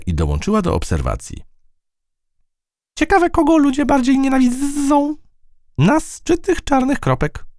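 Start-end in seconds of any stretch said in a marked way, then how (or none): 3.0-7.4 squeaky-high fly with stretched z sounds; very high voice, buzz on every z. Acted. Fly wonders aloud to the mouse, buzzing high. Fly character joins the watch.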